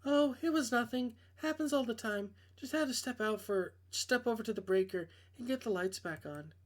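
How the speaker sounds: a hint of a nervous young woman; girl voice. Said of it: "I can't do girl voices".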